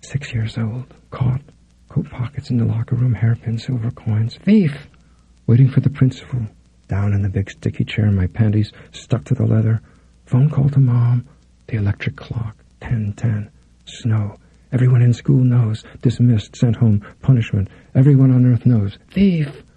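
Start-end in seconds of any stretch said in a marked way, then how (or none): 4.4-4.9 sharp high accusing call; accusing cry. Retold or imitated. Schoolmates branding six-year-old Lydia: accusing cry. 19.1-19.6 sharp high accusing call; accusing cry. Retold or imitated. Schoolmates branding six-year-old Lydia: accusing cry.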